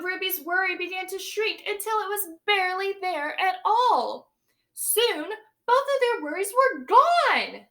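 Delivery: put-on lively read-aloud for young children; storybook reading. A narrator's reading. Teacher reading a picture book to her class, lively storytelling. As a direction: read it big and bright to the class.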